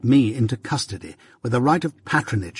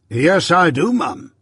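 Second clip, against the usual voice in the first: high, louder pompous figure; self-important interjection; 6 semitones higher, 4 dB louder, slower than usual. A narrator's reading. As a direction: cut in grandly, voice raised. Pumblechook cuts in self-importantly.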